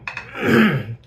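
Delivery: loud strained hack from the throat; gagging throat clear. Real, not acted. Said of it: "me coughing and gagging".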